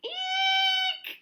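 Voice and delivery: very high, loud shriek of fright; bears shrieking eek. A narrator's reading. The three bears shrieking at Goldilocks waking, high shriek. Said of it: "cried the three bears".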